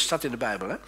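lively read-aloud with voiced quarrel; animated scripture reading; Bible story reading. A narrator's reading.

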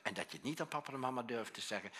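ordinary delivery

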